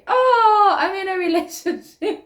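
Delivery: squealed high, as if just remembering; mock surprise. Vlogger acting out forgetting she is taken, mock surprised. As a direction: gasp it out like a sudden realization. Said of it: "you sometimes forget".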